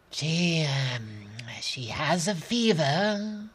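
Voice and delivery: soft, slow, weary woman; tired doctor voice. Acted. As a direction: say it softly and tiredly. Doctor to Lena.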